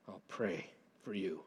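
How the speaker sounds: an earnest, intense promise under the breath; hushed intensity. Shown as a demonstration. Preacher promising prayer for elders and staff, hushed and intense. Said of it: "say that with intensity".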